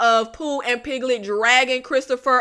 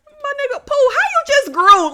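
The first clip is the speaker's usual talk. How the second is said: mock disbelief; 12 semitones higher than usual. Mock addressing Pooh.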